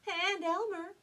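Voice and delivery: high mama duck cry. Acted. Mama duck reminding the flock, crying out. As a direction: call it out high and urgent. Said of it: "Mama cried out".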